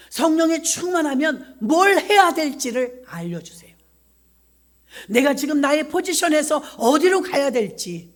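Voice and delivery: loud, rising sermon plea; fervent preaching. Acted. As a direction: preach it with full force. Preaching at full force.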